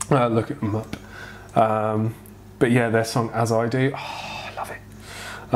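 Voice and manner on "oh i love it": soft, slow, dreamy whisper. Real, not acted. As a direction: hush it out lovingly. Whispered love for song.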